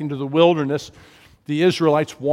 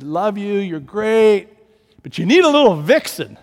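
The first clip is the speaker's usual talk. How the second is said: fond dad voice; 5 semitones higher, 7 dB louder than usual. Retold or imitated. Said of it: "He said".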